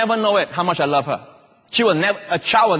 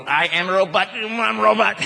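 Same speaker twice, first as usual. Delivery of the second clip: playful robot voice, rising on the repeat; 3 semitones higher than usual. Retold or imitated. Father imitating his small daughter's robot game. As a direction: play at being a little robot. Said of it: "like to see her".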